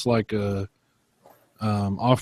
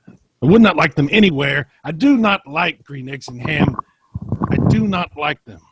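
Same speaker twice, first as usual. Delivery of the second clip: higher, louder stage-style refusal; dramatic refusal; 5 semitones higher, 6 dB louder than usual. Acted. Sam refusing Dracula's food, a loud dramatic protest. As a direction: protest it like a tragic hero. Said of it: "very dramatic stuff going on".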